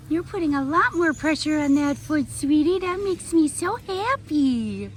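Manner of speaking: high, tender praise; high pet-directed praise, slowing at the end; praising a pet. Real, not acted. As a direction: coo encouragement at an injured pet. Praising the pet's healing foot.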